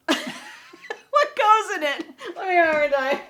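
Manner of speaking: high, slow words through laughter; laughing fit. Real, not acted. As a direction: fight through giggles, near tears.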